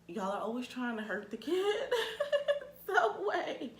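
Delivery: breaks into a high laugh mid-sentence; rueful laugh at always meeting creepy men. Real, not acted. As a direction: laugh through the end of it. Laughing at her own complaint.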